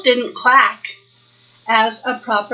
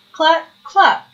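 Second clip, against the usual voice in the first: clucking; 4 semitones higher than usual. Acted. Duck character clucks.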